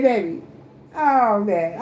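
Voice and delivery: ordinary talk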